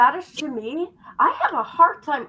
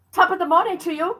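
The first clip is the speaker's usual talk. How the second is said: quick, higher Irish lilt on the greeting; 6 semitones higher, faster than usual. Retold or imitated. Greeting her friend in a playful Irish accent. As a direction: toss it off like a cheery Irish local. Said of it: "That's Irish".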